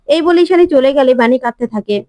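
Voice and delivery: usual speaking voice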